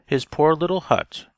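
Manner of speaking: ordinary delivery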